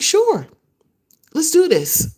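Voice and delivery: high, bright mock enthusiasm from him; eager yes. Ken agreeing to come on the show, voiced high and eager. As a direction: imitate him jumping at the offer. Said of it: "he's like".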